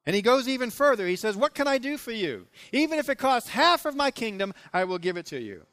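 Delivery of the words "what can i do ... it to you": lifted, expansive offer from the king; a king promising half his kingdom; the king making a grand offer to Esther; generous king. Acted. King's generous offer voiced.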